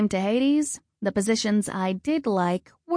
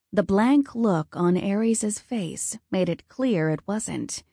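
ordinary delivery

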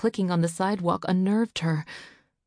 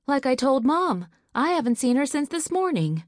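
put-on higher, louder young boy; boy's voice; 8 semitones higher, 4 dB louder than usual. Son answering his angry father, higher boy's voice. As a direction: play the boy defending himself.

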